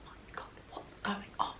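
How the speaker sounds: unvoiced, hushed "oh my God"; panicked whisper. Dad asking about the boy, panicked whisper.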